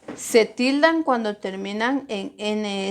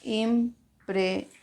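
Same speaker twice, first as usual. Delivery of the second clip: "Impresión" slowly split into im, pre, sión; syllable by syllable; 5 dB quieter, much slower than usual. Shown as a demonstration. Teacher separates the word for pupils, "Impresión" syllable by syllable. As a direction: say "Impresión" with a pause after each syllable. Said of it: "al separarlas".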